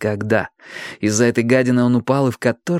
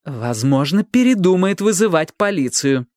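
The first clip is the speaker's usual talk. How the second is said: firm, distinct, raised pitch; clearly articulated, determined statement; clear and resolute; 7 semitones higher than usual. A narrator's reading. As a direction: finish the sentence decisively. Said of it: "чётко и решительно закончила Робин".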